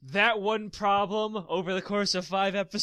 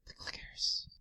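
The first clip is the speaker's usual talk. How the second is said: creepy whisper; 10 dB quieter, slower than usual. Pretend. Echoing the scary clickers.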